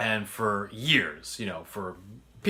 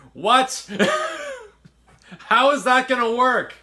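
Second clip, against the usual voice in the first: much higher and louder, laughing through it; laughing disbelief; 14 semitones higher, 10 dB louder than usual. Retold or imitated. Ishmael hearing the plan, loud laughing disbelief. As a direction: cackle in disbelief at the idea. Friend's incredulous reaction retold.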